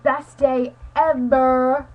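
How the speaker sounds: loud, drawn-out thrill; big slow burst of joy; excited exclamation. Retold or imitated. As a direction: draw each word out with loud delight. Retelling delight at arrival.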